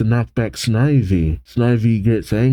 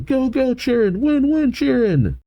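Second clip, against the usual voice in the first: much higher, bouncy cheer from the stands; cheering fan; 13 semitones higher than usual. Acted. Leo cheering Chirin on from the stands, high and eager. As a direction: chant it like an eager fan in the bleachers. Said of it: "Leo's cheering from the stands, going".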